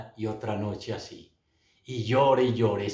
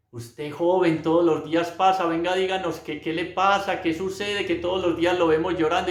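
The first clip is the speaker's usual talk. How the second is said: put-on higher-pitched, prying old-lady questioning; nosy old lady; 6 semitones higher than usual. Old lady questioning the young man who cries daily, nosy. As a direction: imitate an inquisitive old gossip pressing for answers. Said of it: "una viejita le dio un codazo".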